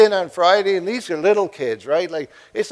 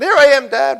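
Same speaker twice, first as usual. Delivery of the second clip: put-on higher and louder, breezy kid voice; cheery little girl; 10 semitones higher, 7 dB louder than usual. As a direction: pop out like nothing happened. Daughter strolls out from hiding.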